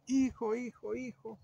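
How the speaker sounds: high-pitched mock call to a son; mock mother's cry. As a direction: imitate a mom calling out, without yelling. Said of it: "la mamá empieza a gritar". (grito fingido de mamá, agudo)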